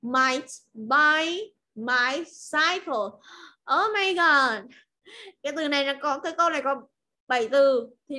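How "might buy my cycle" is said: That guy might buy my cycle said slowly; teacher models the last sentence for students; very slow model reading. Shown as a demonstration. Teacher models very slowly. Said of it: "And the last one".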